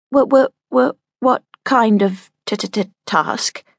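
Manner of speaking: slow nervous stammer on t-t-t-task; stutter. Acted. Nesta stammering at the visitor. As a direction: repeat and trip, very slow. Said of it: "asked Nesta".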